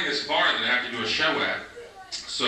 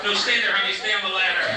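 calling out to a heckler; 8 semitones higher, 3 dB louder than usual. Real, not acted.

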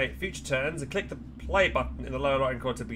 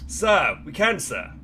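loud gung-ho soldier; 3 semitones higher, 7 dB louder, slower than usual. Acted. Recruits answering drill sergeant.

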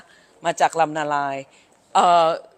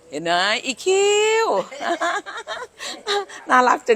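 cooing; 9 semitones higher than usual. Real, not acted. Cooing at grandchild IQ.

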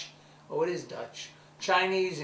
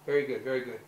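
ordinary talk

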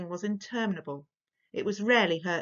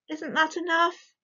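desperately; 10 semitones higher than usual. Acted. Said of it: "her mother said desperately".

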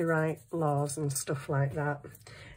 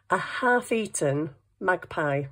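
usual speaking voice

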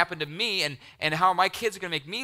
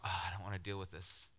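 much softer and lower, worn-out groan; weary parent sigh; 7 semitones lower, 14 dB quieter than usual. Retold or imitated. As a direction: sound too tired to deal with fighting kids. Tired parent voiced.

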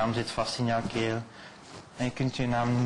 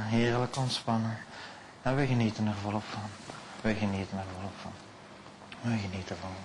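hypnotic induction; 3 semitones lower, 5 dB quieter than usual. Acted. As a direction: lull the subject down into trance. Hypnotist inducing trance on stage.

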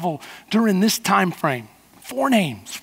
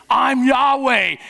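slow shout; slower than usual. Real, not acted. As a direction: draw out I'm Yahweh at full voice.

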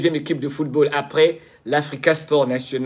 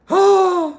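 a high, bright gasp of delight; light up at a familiar name; 13 semitones higher, 4 dB louder than usual. Real, not acted. Streamer spotting viewers in the chat, delighted.

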